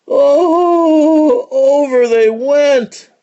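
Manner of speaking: long high, loud "oh", then slow; dramatic wail. A narrator's reading. Narrator tells the stagecoach tumbling off the cliff, high drawn-out cry. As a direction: cry it out, stretched like a long fall.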